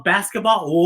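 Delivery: ordinary delivery, nothing marked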